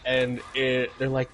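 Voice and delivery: ordinary talk, nothing marked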